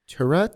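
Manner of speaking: "Tarot" offered as tuh-RUT, questioning; tentative pronunciation. Shown as a demonstration. Co-host guessing the word, "Tarot" tried as tuh-RUT. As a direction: try "Tarot" with an unstressed first syllable, unsure. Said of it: "I think it's".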